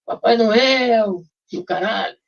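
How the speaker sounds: mock cheer. Guys cheering him retold.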